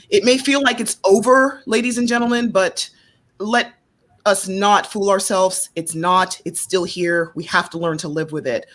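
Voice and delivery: normal talking voice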